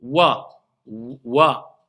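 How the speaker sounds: Wa with lips pushed round. Shown as a demonstration. Teacher showing how the lips move on Wa. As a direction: push the lips forward on Wa. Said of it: "Là, les lèvres bougent".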